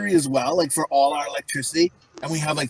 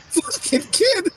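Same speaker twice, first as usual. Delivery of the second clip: laughing exclamation; much slower than usual. Real, not acted. Laughing outburst, slow high.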